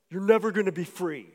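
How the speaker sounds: imitating a loud, ominous voice; forceful, mock-ominous declaration; loud menacing voice. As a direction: boom it out like a voice pronouncing doom. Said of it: "this really loud, deep voice".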